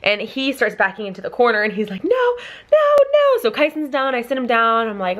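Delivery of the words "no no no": high, loud mock panic; retold terrified refusal, high-pitched; imitating freaked-out child. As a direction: squeal it like a panicking little boy. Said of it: "he's freaking out".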